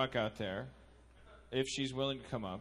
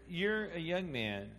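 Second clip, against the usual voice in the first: slow solemn; 5 dB louder than usual. Acted. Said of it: "mock solemn advice".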